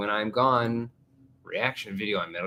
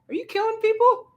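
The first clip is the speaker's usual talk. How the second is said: high squeaky voice; 9 dB louder than usual. Acted. Mock incredulous high voice.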